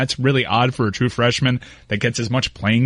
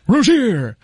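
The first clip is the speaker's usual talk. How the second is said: hammed-up sportscaster cry of "Rozier"; big mock announcer call on "Rozier"; Josie; 10 semitones higher, 4 dB louder than usual. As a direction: stretch "Rozier" out, leaping high on the end. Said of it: "pronounce it like Josie".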